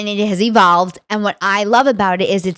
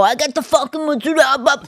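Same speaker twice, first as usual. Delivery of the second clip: mock heavy Italian-American accent; 6 semitones higher than usual. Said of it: "I don't want people to go".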